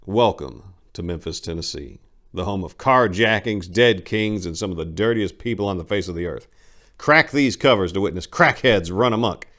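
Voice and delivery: punchy announcer-style read of the back cover; dramatic blurb read. Acted. Narrator selling the book to listeners, punchy trailer read. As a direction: sell the city like a movie trailer.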